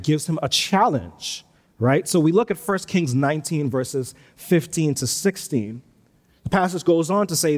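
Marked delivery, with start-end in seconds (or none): none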